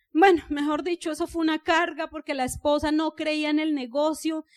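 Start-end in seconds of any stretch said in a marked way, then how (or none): none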